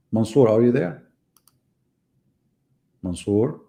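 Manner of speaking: ordinary talk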